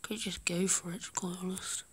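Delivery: hushed gaming commentary; whispering. Real, not acted. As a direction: keep it to a whisper. Holds whisper throughout.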